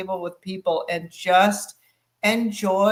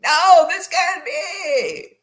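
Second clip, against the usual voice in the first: high, slow, exasperated French chef; hammed-up outraged French cook; 10 semitones higher, 6 dB louder, much slower than usual. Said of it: "He's like".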